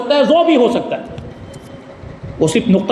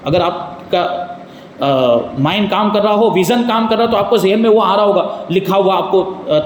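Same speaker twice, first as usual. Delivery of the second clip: usual speaking voice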